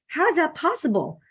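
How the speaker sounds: high-pitched retold amazement; incredulous, voice lifting high; amazed question. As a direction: ask it as if baffled by good news. Retold amazement at friend's recovery.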